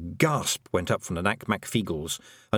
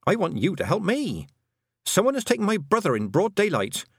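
higher-pitched, insistent heroine; Tiffany, urgent; 10 semitones higher than usual. A narrator's reading. Girl asking the men to find her brother, urgent. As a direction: demand help with urgency.

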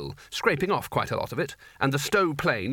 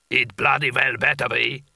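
rumbling giant; 4 dB louder than usual. A narrator's reading. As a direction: rumble it like a huge ice giant. Giant figure.

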